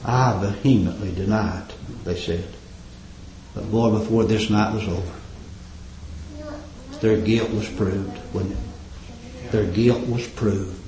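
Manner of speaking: low, soft preaching murmur; quiet grave sermon voice; hushed. Acted. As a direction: bring it down to a grave hush. Preacher drops to hush.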